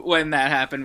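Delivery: ordinary talk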